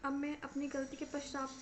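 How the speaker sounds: usual speaking voice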